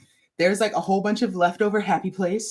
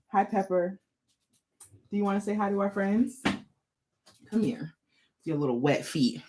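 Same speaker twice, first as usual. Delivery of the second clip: soft dog talk; 4 dB quieter than usual. Real, not acted. Talking to the dog.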